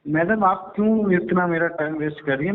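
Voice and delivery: ordinary delivery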